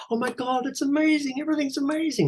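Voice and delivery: very high, gushing falsetto; mock an over-enthusiastic director who loves everything; squealing exaggerated praise; gushing praiser. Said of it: "people who go".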